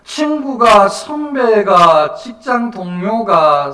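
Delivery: loud, drawn-out list, each item declaimed; full-force preaching. Real, not acted. Preacher listing those who might object, forceful exhortation. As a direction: hit each phrase high and long.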